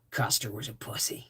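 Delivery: low, gruff growl of a tough sergeant; Sam Elliott impression. Sergeant sneering at Custer, low and gruff. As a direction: drop the voice low and deadpan it. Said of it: "And he goes".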